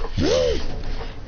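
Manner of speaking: high gasp without voice. Acted. Storyteller acting out a reaction, gasping. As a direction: draw in a high breath.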